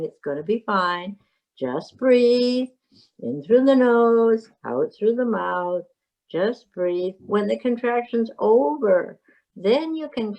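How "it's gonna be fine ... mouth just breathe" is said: slow, drawn-out calming instructions; comforting breathing coach. Acted. Midwife comforting a mother through a contraction, slow and reassuring. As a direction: coach her steadily through each breath. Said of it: "to comfort her and saying".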